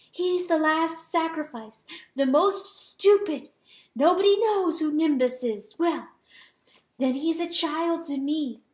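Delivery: high, insistent pleading; anguished protest. A narrator's reading. Hen begging the rooster to let it end, anguished.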